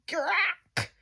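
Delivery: high, creaky imitated crack; high-pitched breaking sound effect; cracking noise. A narrator's reading. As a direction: make the bed go crack.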